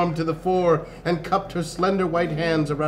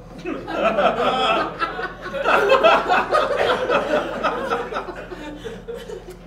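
long high laugh. Real, not acted.

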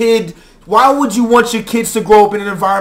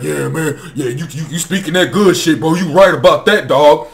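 low, lazy agreement, a touch lower; a hint of an old head nodding along; lightly mocking OG; 7 semitones lower than usual. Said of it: "looking like".

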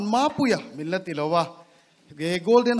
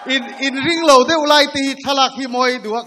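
shouting; 4 semitones higher, 9 dB louder than usual. Real, not acted. Calling out, crowd shouting.

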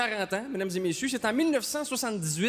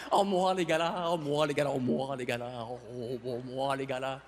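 blasé gala guest; 10 semitones lower, 5 dB quieter than usual. Retold or imitated. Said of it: "Tout le monde ici a dit ça".

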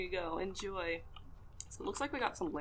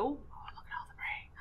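whispered; 8 semitones higher than usual. Real, not acted. Spotting the prey.

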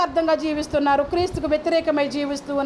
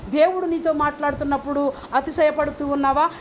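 normal talking voice

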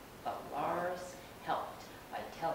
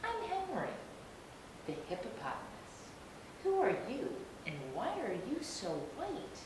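friendly hippo voice; 6 semitones higher than usual. Acted. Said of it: "called the big tan animal".

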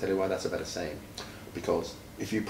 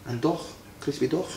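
dog for duck, Scouse vowel, higher with a rise; 9 semitones higher than usual. Shown as a demonstration. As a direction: tease the listener with a Scouse crispy dog. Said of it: "my favourite Scouse words are".

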